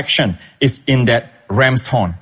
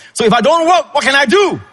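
mock a fretting believer who must work; anxious protester; 11 semitones higher than usual. Mimicking restless believer's protest.

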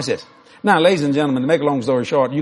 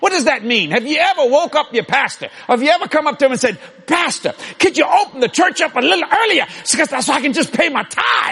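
full-force preaching; 12 semitones higher than usual. Acted. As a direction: drive the point home to the congregation.

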